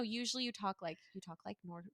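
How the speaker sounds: very soft, low talk; near whisper. Shown as a demonstration. Showing how seatmates usually talk on flights, near whisper. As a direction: drop to a hushed seatmate voice.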